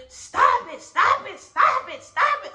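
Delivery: high, loud repeated yell. Retold or imitated. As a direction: shout him down, stop it. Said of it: "Granddaddy start yelling".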